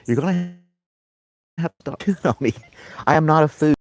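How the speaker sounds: dog-talk voice. Real, not acted. Talking to dog, high voice.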